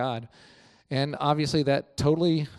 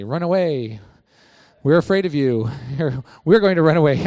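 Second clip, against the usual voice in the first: loud, laughing mock panic; a hammed-up cry of fear; mock frightened Philistines; 2 semitones higher, 8 dB louder than usual. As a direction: ham up the cowards shouting that they'll flee. Mocking the Philistines' reply.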